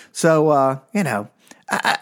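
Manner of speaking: ordinary delivery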